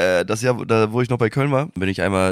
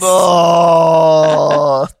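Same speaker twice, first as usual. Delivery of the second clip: groaning boah; 6 semitones higher, 10 dB louder than usual. Real, not acted. Reacting to the pirate joke.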